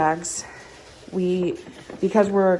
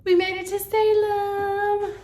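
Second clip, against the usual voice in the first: triumphant; 13 semitones higher, slower than usual. Real, not acted. Triumphant arrival announcement.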